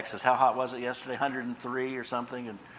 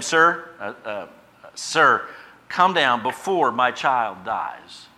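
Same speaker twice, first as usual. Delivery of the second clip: louder, slow desperate plea; pleading nobleman; 2 semitones higher, 8 dB louder, much slower than usual. Acted. Nobleman begging Jesus to save his son, louder slow plea. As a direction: beg like a father whose child is dying. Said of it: "the nobleman said to him".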